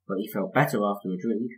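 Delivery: plain reading voice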